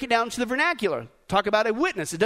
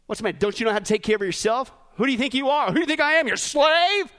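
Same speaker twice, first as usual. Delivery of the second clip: acted indignation. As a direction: build from grumble to indignant shout. Acted indignant refusal, building.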